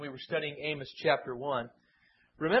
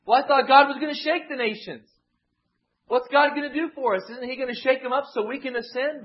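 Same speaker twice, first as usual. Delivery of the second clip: raised, higher, louder voice of a restless crowd; higher, louder plaint of the exiles; impatient exiles; 7 semitones higher, 7 dB louder than usual. Retold or imitated. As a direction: voice the people demanding God act.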